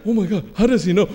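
quick mock panic; freaking out. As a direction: imitate a rushed, alarmed reaction to being found out. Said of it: "'Cause he freaking out".

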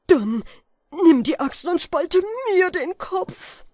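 sehr hoch, sehr laut herausgeschrien; verzweifelter Schrei. A narrator's reading. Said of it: "schrie Falke mit verzweifeltem Tone". (Falke schreit verzweifelt Kaspar an)

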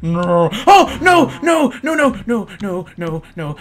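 high, loud frantic nos; shrieking no as the cow runs; panicked no. Real, not acted. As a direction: shout no over and over in panic.

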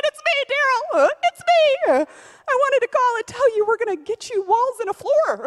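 crying out the good news; overjoyed. Real, not acted. Telling Daryl over the phone about the money, overjoyed and near tears. Calling missionary with big news.

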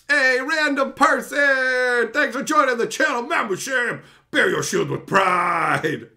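slow, loud, booming thank-you; hyped shoutout. Real, not acted. Streamer loudly thanks a new channel member, slow and booming. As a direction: boom it out like an announcer hyping a new member.

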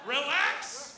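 loud, stretched-out command; loud scolding. Real, not acted. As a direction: settle a shocked crowd with one loud word. Comic calming a shocked audience.

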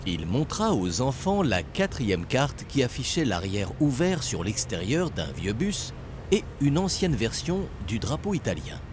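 plain reading voice

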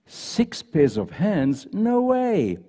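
slow, drawn-out doubting question, then a high "No way"; incredulous disbelief. Acted. Angel balking at God's design, slow incredulous disbelief. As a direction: let her balk at the idea. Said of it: "The angel shook her head slowly and said".

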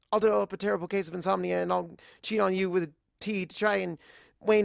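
high, fast, rambling panic; frantic babble. Acted. Twilight rambling at the waitress, high and frantic.

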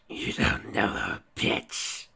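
slow, voiceless nightmare voice; menacing disembodied voice. A narrator's reading. Voice from Octavia's nightmares cuts in, slow menacing whisper. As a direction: drag it out in a harsh whisper. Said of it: "Another voice echoed from nowhere".

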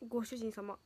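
low, dim master greeting; gloomy maid greeting. Acted. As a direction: drop the voice flat and dark. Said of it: "めっちゃ暗い。".

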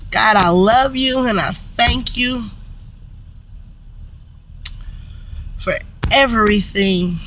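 praying through tears, voice fading to nearly nothing; real tearful thanks to Jesus; tearful prayer. Real, not acted. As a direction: thank God while welling up. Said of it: "I didn't mean to cry".

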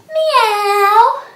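high loud rising meow; a big cat meow. Shown as a demonstration. Teacher counting cat sit-ups with a loud high meow. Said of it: "cat sit-ups".

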